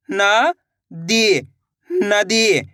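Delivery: न and दी slowly apart, then joined as नदी; sounding out syllables. Shown as a demonstration. Teacher models reading for learners, नदी sounded out slowly. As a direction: say न, pause, long दी, then नदी. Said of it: "इस तरीके से आपको इनका उच्चारण करना है".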